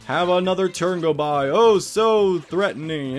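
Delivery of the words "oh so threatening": loud, drawn-out sarcastic horror, easing off; mock dread. Player mocking a weak enemy's turn, sarcastic dread. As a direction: exaggerate how threatening it is, slowly.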